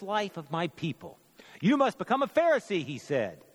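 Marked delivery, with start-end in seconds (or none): 1.6-2.8 high, loud, brisk fatherly order; commanding father. Acted. Father telling his son what he must become, commanding. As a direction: press the order on your son, pitched up and quicker.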